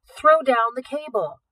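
slow, loud, high command; harsher. Acted. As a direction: order it, every word pressed. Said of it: "harsher now".

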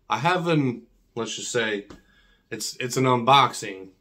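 usual speaking voice

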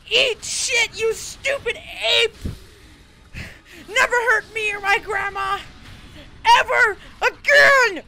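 tearful, strained shouting; hammed-up weeping war cry at the ape; crying battle yell. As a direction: scream through mock sobs.

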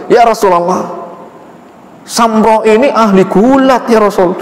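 high, eager father bragging; boastful father. Acted. Father boasts his son is a wrestler, high and eager. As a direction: sell his son to the Prophet. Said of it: "Ngomong ke Rasul".

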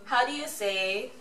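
usual speaking voice

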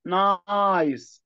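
نايس said slowly with the long i held; stretched long i. Shown as a demonstration. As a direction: draw out the i in نايس. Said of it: "لما الاقي الاي حرف ساكن اي".

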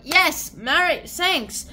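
loud, high, drawn-out cheer; joyful thanks. Acted. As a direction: cheer the thanks with exaggerated joy.

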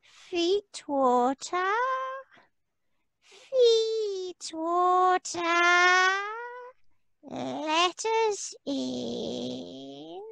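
high, very slow, soft crying plea; wailing fairy folk. Acted. Shut-out creatures begging the feet water, slow high wail. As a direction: draw each word out like a lost spirit.

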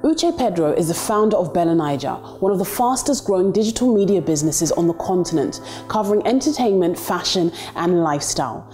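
scripted profile voiceover with lifted phrase openings; documentary narration. A narrator's reading. Introducing the founder of a digital media business, narrated profile read.

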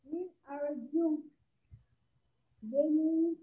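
soft, slow reading; too quiet. Real, not acted. Pupil reading the question aloud to the teacher, too quiet. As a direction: read it softly and slowly, barely projecting. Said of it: "Más fuerte, mi amor".

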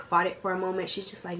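ordinary delivery

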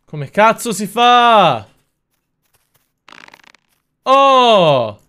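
high, loud, slow drawn-out yell; exasperated yell. Real, not acted. Streamer yelling at the game, slow and loud. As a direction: bellow it at the screen, losing patience. Frustrated at game, asks chat.

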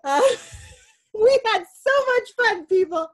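high, loud excited cheer; excited shout. Real, not acted. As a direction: whoop it up with joy.

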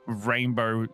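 normal talking voice

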